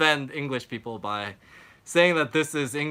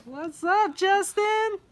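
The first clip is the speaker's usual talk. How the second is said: excited greeting; slower than usual. Real, not acted. Greeting a friend on stream.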